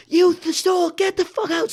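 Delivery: imitated high-pitched furious scream. Guard slamming on her trunk, mock high scream. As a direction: yell it high, as the accuser.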